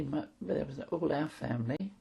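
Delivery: normal talking voice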